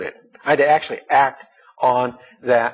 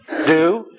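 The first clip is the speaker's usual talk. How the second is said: "Do" punched alone, higher and firm; stress on do; 5 semitones higher than usual. Shown as a demonstration. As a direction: hit the single word "Do" hard. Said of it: "Emphasis on the do".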